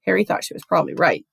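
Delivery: ordinary delivery, nothing marked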